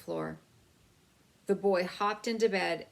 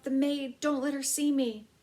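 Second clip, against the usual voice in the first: panicked cry; 8 semitones higher, 4 dB louder than usual. A narrator's reading. Mouse panics at maid's approach.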